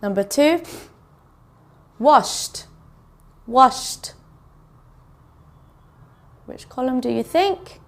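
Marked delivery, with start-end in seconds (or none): 2.0-4.1 WASHED twice, each ending in T; teacher modelling the T ending of washed; T sound at the end. Shown as a demonstration. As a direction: repeat washed with a pause between, ED as T. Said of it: "Sounds like a T".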